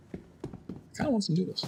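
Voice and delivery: secret whisper, confiding. Real, not acted. Streamer admits to viewers he wants noodles, whispered. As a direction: let it slip under your breath. Confessing craving aside.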